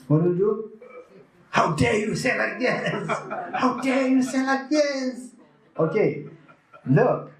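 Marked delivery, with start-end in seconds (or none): 1.5-3.1 indignant how-dare-you, higher voice; mock indignant outburst. Retreat-goer snapping back at a critic, indignant. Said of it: "you become so angry". 3.6-5.3 much higher, slower, drawn-out indignation; rising mock fury. Retreat-goer escalating at a critic, higher and slower. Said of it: "you become so angry".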